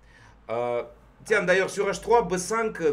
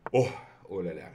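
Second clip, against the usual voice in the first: normal talking voice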